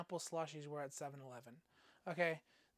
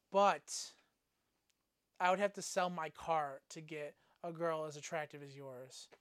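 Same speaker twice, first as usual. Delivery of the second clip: ordinary delivery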